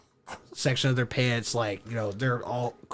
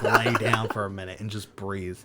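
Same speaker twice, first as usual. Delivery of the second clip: ordinary talk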